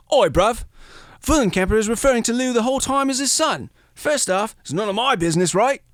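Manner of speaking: a lightly hammed London accent; mock British accent. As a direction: play Bencher as a cheeky London bloke. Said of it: "It's my terrible German accent".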